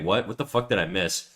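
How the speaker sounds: ordinary talk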